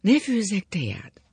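slow, high, hushed concern; worried question. A narrator's reading. Wife offering tea to pale drunk husband, worried. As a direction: ask anxiously, almost breath only. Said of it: "aggódva kérdezte tőle".

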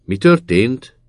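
sharp rising alarmed question; startled question. A narrator's reading. Gendarme startled by the accident news, alarmed rising question. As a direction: jump at the news, asking fast. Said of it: "kérdezte a csendőr".

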